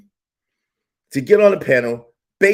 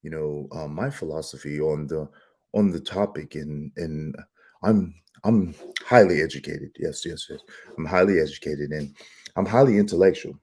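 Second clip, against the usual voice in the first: very low, soft, pompous murmur; mock the fake-smart panelists in a deep hushed tone; pseudo-intellectual voice; 6 dB quieter than usual. Vlogger imitating pompous panelists, low hushed voice. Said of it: "they talk like this".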